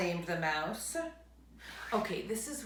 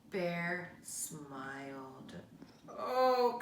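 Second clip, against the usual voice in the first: ordinary delivery, nothing marked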